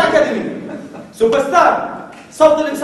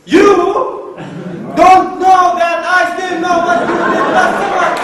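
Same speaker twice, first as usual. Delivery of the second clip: very slow, loud, higher trailer voice; booming trailer title; 6 semitones higher, 6 dB louder, much slower than usual. Shown as a demonstration. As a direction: drag out the absurd title, building big. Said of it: "شو كان بده يكون اسمه".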